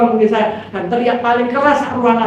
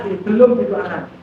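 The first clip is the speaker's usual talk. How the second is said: ordinary delivery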